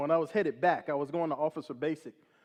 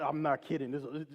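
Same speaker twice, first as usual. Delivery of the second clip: ordinary delivery, nothing marked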